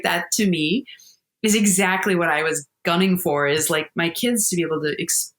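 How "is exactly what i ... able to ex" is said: usual speaking voice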